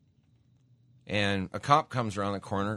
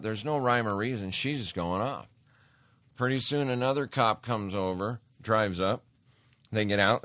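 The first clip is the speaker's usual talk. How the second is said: usual speaking voice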